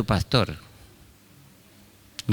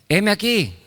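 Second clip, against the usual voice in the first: put-on eager young Samuel; 9 semitones higher than usual. Samuel answering.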